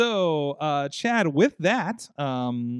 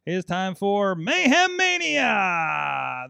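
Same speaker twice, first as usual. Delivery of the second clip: announcer voice; 4 semitones higher, 5 dB louder, much slower than usual. Acted. Announcing the show segment.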